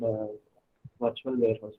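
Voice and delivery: ordinary talk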